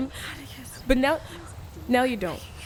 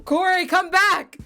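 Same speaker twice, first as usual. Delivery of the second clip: calling out; 4 semitones higher, 5 dB louder than usual. Acted. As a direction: call after her, raised and pleading. Calling after Corey leaving.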